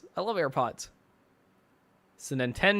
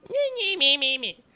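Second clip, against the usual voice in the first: high-pitched mocking "mee-mee-mee"; very high, nasty little mimicking noise; mocking whine noise; 9 semitones higher, 3 dB quieter than usual. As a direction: squeal nonsense syllables to mimic a whiner. Mimics a whining complainer.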